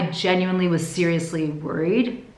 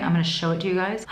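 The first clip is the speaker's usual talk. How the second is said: ordinary delivery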